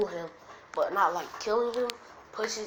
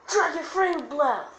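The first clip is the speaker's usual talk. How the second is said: high, loud anime attack shout; battle cry; 8 semitones higher, 9 dB louder than usual. Acted. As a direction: yell the jutsu name, last words drawn out. Acted anime attack call, retake.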